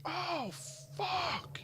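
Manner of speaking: high, drawn-out 'oh no' reaction; slow-motion dismay. Retold or imitated. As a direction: stretch it out high, watching it happen helplessly. Watching crash in slow motion.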